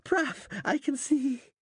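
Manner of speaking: high, loud joyful scream. A narrator's reading. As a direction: scream out at the first light. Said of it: "he screamed".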